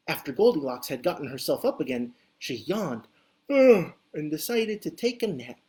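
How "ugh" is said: yawn sound. Acted. Goldilocks yawns.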